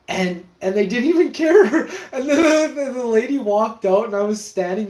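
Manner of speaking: high, loud laughing retelling; can barely get it out for laughing; laughing while telling. Real, not acted. Telling a friend the gross story, laughing high and loud.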